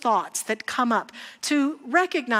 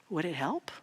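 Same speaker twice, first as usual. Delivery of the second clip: soft, low little voice; a quiet inner voice asking calmly; 6 semitones lower, 10 dB quieter than usual. Retold or imitated. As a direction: say it gently, as an inner voice. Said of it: "his little voice saying".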